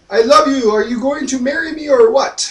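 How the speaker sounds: high, loud, hammed-up suitor; mock proposal. Francis going up to Jane, high mock proposal.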